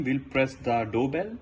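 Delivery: ordinary talk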